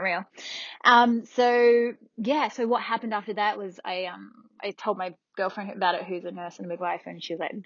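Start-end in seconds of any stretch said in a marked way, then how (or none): none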